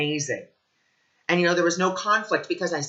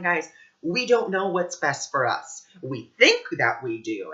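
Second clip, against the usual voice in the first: normal talking voice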